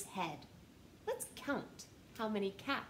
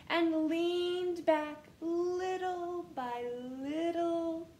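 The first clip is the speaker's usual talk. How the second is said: slow drawn-out reading, higher; 5 semitones higher, 5 dB louder, slower than usual. Acted. Reader slowing down as the peddler leans back. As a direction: ease it out bit by bit.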